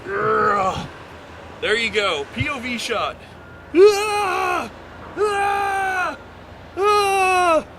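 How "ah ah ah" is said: high playful ride screams; mock coaster screams. POV shot, mock coaster screams.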